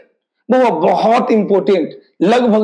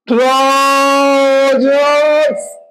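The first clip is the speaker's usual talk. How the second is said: Trousers stretched very slowly, higher, ending on a clear s; slow drawn-out teaching word; 4 semitones higher, much slower than usual. Shown as a demonstration. Teacher to students, showing trousers slowly and drawn out. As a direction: draw trousers out slowly so students catch the final s. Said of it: "तो trousers का मतलब क्या?".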